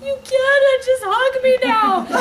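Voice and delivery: high, loud, laughing "just hug me now"; relieved demand. Retold or imitated. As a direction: shout it gleefully, high and slow. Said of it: "and I'm like".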